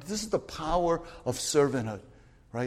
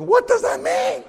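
high, loud, slow puzzled cry; bewildered high, loud, slow question; baffled outburst; 12 dB louder than usual. Retold or imitated. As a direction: act out the confusion. Retold puzzled self.

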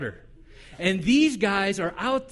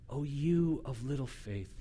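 soft, low gentle rebuke; gentle, quiet question; Jesus softly asking Peter why he doubted; tender; 6 semitones lower, 11 dB quieter than usual. Acted.

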